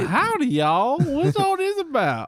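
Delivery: high Southern cowboy twang; howdy like a rodeo hand. Pretend. Playing Cowboy Tim.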